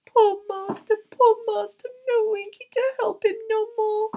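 very high, loud elf wail; hammed-up high-pitched sobbing lament. A narrator's reading. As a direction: wail through sobs as a tiny elf. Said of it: "hear her sobbing into her skirt".

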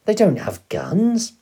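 high, loud, drawn-out disbelief; shocked gasp. A narrator's reading. Boy doubting his father about armed keepers, shocked. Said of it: "I gasped".

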